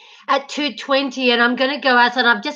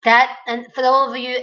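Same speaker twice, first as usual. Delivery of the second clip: ordinary delivery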